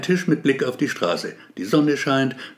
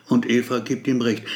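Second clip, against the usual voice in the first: ordinary delivery, nothing marked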